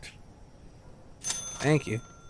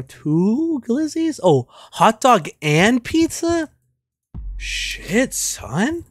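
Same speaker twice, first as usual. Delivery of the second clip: higher, drawn-out amazement; surprised; 9 semitones higher, much slower than usual. Real, not acted. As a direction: wow at the order. Shocked at big order.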